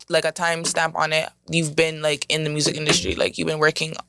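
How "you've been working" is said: usual speaking voice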